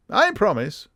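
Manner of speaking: high-pitched English vow; Sherlock Holmes. Acted. Detective giving the count his word, high English voice. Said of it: "his high-pitched, very English voice".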